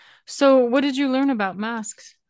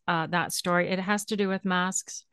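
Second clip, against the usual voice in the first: ordinary talk, nothing marked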